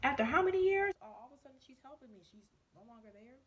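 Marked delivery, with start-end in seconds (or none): 0.0-0.9 very high, louder disbelief; genuinely stunned, pitch leaping; shocked. Retold or imitated. Hearing that Gina no longer works there, high and shocked. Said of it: "I'm like".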